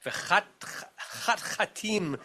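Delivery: halting retry of a tongue-twisting word; careful second attempt at difficult Hebrew; stumbling over pronunciation. Real, not acted. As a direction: break off on the first syllables, then get through it carefully. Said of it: "I always have a hard time pronouncing".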